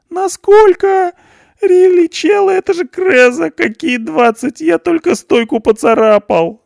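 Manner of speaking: very high, loud terrified squeal. A narrator's reading. Punk hears twenty years, squeals in terror. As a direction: shriek in panic at twenty years. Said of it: "заверещал перепуганный Панк".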